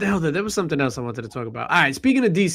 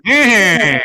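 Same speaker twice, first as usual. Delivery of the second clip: grunting Eh; 5 semitones higher, 10 dB louder than usual. Pretend. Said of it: "He's going".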